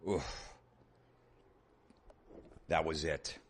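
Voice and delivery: ordinary delivery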